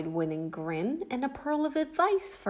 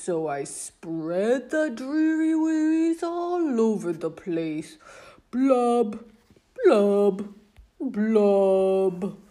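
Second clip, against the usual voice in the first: louder sulky singsong with booming blubs; loud gloomy fish refrain; 6 dB louder, slower than usual. Acted. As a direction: belt the mopey refrain. Refrain again.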